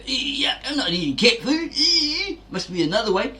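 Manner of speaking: high, mocking voice of a reluctant doubter. Mocking people who refuse the challenge, whiny and high. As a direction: squeak out the refusal like a whiny skeptic. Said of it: "A lot of people out there will go".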